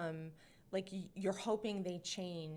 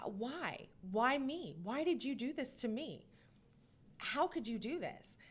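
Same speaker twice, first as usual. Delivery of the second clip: higher-pitched, wounded questioning; hurt, pleading; 5 semitones higher than usual. Acted. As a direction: plead like a victim still in pain. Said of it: "still in pain and hurt".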